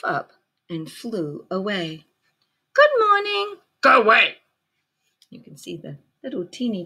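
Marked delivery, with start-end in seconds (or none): 2.7-3.6 friendly ladybug voice. Acted. Recap of friendly ladybug's greeting. 3.8-4.4 grouchy ladybug voice. Acted.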